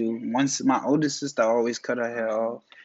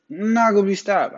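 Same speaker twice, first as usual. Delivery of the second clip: higher, punchier hype repeat; hyped up; 7 semitones higher, 3 dB louder than usual. Real, not acted. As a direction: pump yourself up, declare it. Repeats the line, then louder.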